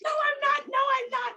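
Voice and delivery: high, squeaky no, I'm not; a shy, giddy refusal; squealing refusal. Retold or imitated. Refusing her friend's invitation to meet her crush, squealing. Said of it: "And I said".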